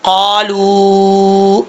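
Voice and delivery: قالو with a very long held final oo; slow tajweed elongation of قالو; long madd. Shown as a demonstration. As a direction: stretch قالو steadily on one note. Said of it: "اڑھائی الف کے برابر لمبا کر کے پڑھا جائے گا".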